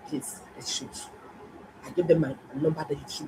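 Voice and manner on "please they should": hushed whispered plea; begging whisper. Retold or imitated. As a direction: quietly beg the nurses. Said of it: "and begged them".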